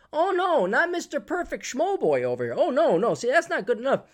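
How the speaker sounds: high, loud mocking protest; hammed-up scornful "oh no"; sarcastic mockery. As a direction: jeer at the perfectionist. Sarcastic jab at Mister Perfect.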